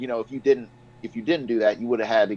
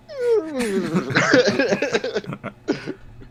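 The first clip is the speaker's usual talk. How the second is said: bursting out in a high laugh; 13 semitones higher than usual. Real, not acted. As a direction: laugh at the silly animation. Laughing at funny animation.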